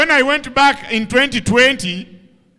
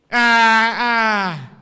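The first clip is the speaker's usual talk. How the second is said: dismissive ah ah. Retold or imitated. Mimicking a dismissive reaction.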